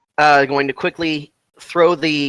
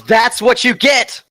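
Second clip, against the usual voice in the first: high, slow, loud scream of triumph; screamed taunt; 9 semitones higher, 4 dB louder, slower than usual. Acted. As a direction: scream it at the beaten goblin. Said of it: "I'm going to scream the words".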